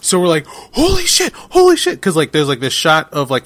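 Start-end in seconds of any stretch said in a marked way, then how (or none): 0.5-2.0 very high squeal, imitating excited kids; retold freak-out. Grown fan retelling a grade-school discovery, high-pitched mock freak-out. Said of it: "we were freaking out".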